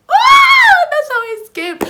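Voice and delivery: very high, loud cry of relief; triumphant shout. Real, not acted. Telling viewers her escape, triumphant shout.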